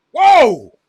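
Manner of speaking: a loud, high, startled whoa; surprised whoa. Real, not acted. Streamer watching the game suddenly reacts, loud startled whoa. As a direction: react in real shock at the score.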